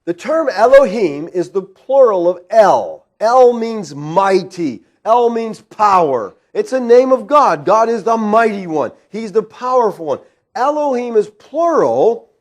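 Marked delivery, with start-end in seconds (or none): none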